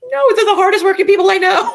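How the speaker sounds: emphatic high rush of conviction; emphatic outburst. Real, not acted. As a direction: defend them with a burst of passion. Emphatic defense of teachers.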